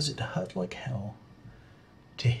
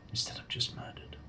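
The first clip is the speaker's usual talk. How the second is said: whispering; 2 semitones lower, 6 dB quieter than usual. Real, not acted. Aside on the question.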